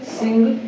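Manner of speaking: सिंग with a clear final ng; pronunciation demo. Shown as a demonstration. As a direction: model सिंग for the class. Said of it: "उसी तरीका से सिंग". (सिंग का साफ उच्चारण)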